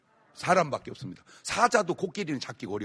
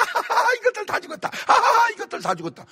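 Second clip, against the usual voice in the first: much higher, cackling triumph over the cancer; gleeful laugh; 6 dB louder than usual. Retold or imitated. Patient taking pills gloats, high mock laughter. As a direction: laugh as if crushing the cancer cells.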